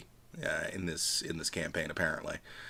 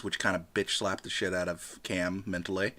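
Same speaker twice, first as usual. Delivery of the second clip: ordinary talk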